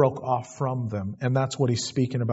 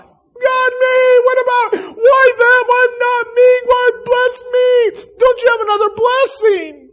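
high, loud mock crying shout; hammed-up sobbing complaint to God; wailing and shouting through mock tears, much higher; self-pitying wail; 10 dB louder than usual. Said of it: "I can just see myself before God".

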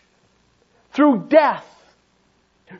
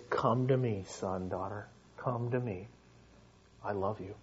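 much softer, lower loving invitation; tender call; 13 semitones lower, 14 dB quieter than usual. Acted. God lovingly calling a sinful child, very soft and tender. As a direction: gently call them home. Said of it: "the word of conviction to you is".

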